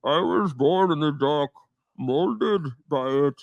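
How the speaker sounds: slow, menacing masked villain voice; slow, muffled villain drawl; Bane impression. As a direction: draw each word out like Batman's Bane. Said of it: "I do the Bane impersonation from Batman".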